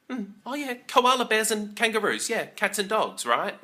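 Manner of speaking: high, sing-song mock agreement; sarcastic. Answering an American friend, high sarcastic yeah. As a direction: pitch way up, play along sarcastically. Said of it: "she actually thought that Aussies kept kangaroos".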